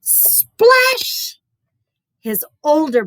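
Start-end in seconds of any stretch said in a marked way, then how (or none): 0.0-1.4 high squeaky splash; splash sound effect. Acted. Narrator for kids, a high playful splash. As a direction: make the splash pop, high and bright. Boy falls into well.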